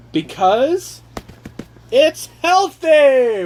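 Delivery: drawn-out hyped reveal; grand announcement. Pretend. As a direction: announce it like a game show reveal. Announcing reason with fanfare.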